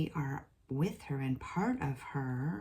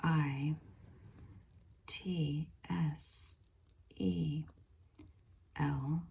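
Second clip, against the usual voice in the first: each letter whispered apart with long gaps; slow spelled channeling; 3 dB quieter, much slower than usual. Acted. Channel spelling the spirit's message, hushed and slow.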